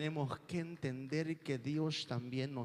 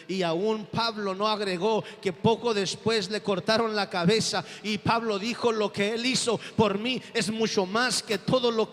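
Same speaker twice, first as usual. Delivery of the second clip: louder, higher preaching build; impassioned preaching; 4 semitones higher, 8 dB louder than usual. Acted. Preacher telling the church of Paul's martyrdom, impassioned. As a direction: push the story of Paul with rising fervour.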